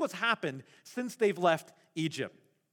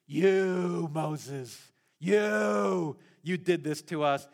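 the crowd yelling blame at Moses; accusing shout; 3 semitones lower, much slower than usual. Retold or imitated. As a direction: blame Moses to his face. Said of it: "They're saying".

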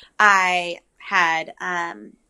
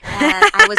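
high, loud stunned gasp of a line; a high squeal of disbelief; shocked; 13 semitones higher, 9 dB louder than usual. Retold or imitated. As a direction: sound floored by the news. Shocked reaction at positive test.

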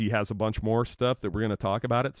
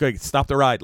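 calling out; 6 semitones higher, 7 dB louder than usual. Retold or imitated.

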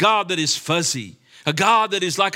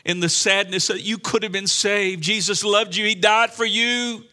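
building, higher sermon cry; impassioned preaching; 6 semitones higher, 4 dB louder than usual. Real, not acted. Preacher pleads that the lost could have been saved, impassioned preaching. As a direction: plead and build to a raised challenge.

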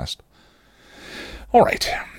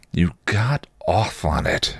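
accusing; slower than usual. A narrator's reading. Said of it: "she accused".

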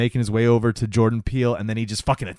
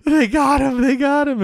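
much higher, louder terrified cries; panicked scream; 14 semitones higher, 4 dB louder than usual. Retold or imitated. Key hiding behind a car, screaming in panic. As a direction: squeal it like he's seen his friend torn apart. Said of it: "Key keeps screaming".

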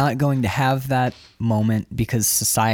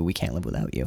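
hushed, tender whisper of a confession; soft unspoken plea; 7 semitones lower, 6 dB quieter, faster than usual. Retold or imitated. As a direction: drop low and soft, almost to yourself. Voicing the unspoken line softly.

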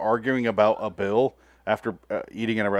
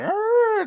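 spooky seance moan. Retold or imitated. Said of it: "is like".

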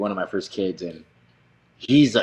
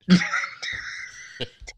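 breathless laugh; 9 semitones higher than usual. Real, not acted. Laughing at own story.

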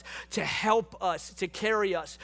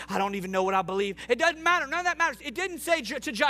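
preaching at full force; 4 semitones higher than usual. Real, not acted. Pastor assuring the congregation God never leaves, impassioned preaching.

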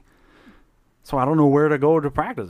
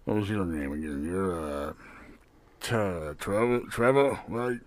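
low gruff barkeep; 7 semitones lower, 3 dB quieter than usual. Acted. Barkeep NPC voice.

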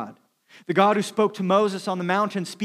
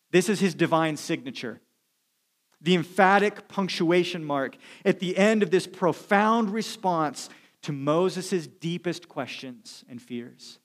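ordinary delivery

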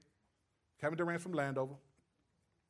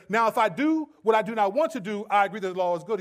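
scripture quote; 3 semitones higher, 8 dB louder, faster than usual. Real, not acted. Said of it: "So he says".

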